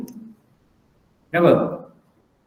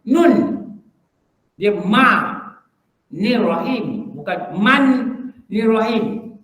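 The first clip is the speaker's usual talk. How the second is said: ordinary talk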